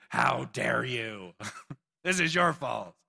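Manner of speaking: slow, drawn-out indignant complaint; mock outrage. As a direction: play the offended crowd blaming Moses. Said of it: "They say".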